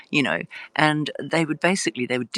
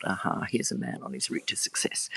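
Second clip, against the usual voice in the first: low conspiratorial whisper, much softer; scheming whisper; 11 semitones lower, 8 dB quieter than usual. Retold or imitated. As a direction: whisper her scheming thought like a secret. Scheming stepgrandmother's thought voiced.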